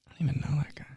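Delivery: soft unvoiced whisper; whispered aside. Real, not acted. Podcaster whispering a confession to listeners about strangers. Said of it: "whispered aside".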